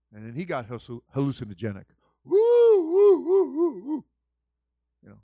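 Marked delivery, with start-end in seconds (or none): 2.2-4.0 very high loud whooping; high loud woo woo; tripping woo. Pretend. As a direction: whoop like someone high on a trip. Acting hallucinating Adam and Eve.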